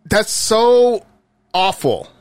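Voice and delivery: ordinary talk, nothing marked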